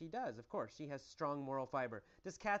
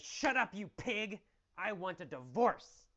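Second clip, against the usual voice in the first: angry yell; 4 semitones higher, 5 dB louder than usual. Acted. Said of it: "Well, she says".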